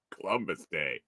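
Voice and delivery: ordinary talk, nothing marked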